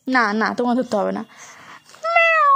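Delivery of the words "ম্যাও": very high, loud playful meow; cat meow for baby. Shown as a demonstration. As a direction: meow high like a kitten to make her laugh. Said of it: "ও ম্যাও ম্যাও করলেই ও হাসে". (উঁচু গলায় বিড়ালের ম্যাও)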